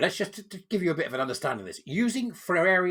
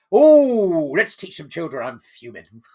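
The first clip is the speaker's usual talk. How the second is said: a hammed-up indignant voice, loud at the start then tailing off; mock fuming activist; 12 dB louder, slower than usual. Mocking the activists he reads about, with loud put-on outrage. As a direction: sneer at them as a sulky outraged youth.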